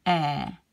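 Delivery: "E" as the vowel in care; low, open eh on "E"; open French è. Shown as a demonstration. Teacher repeats the sound from care, open è.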